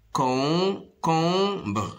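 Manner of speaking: "Concombre" in three separated beats; stretched syllables with gaps in "Concombre". Shown as a demonstration. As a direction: sound out "Concombre" as con, com, bre. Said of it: "se compose de trois syllabes".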